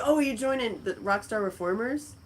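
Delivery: ordinary delivery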